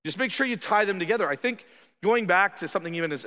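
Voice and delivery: ordinary talk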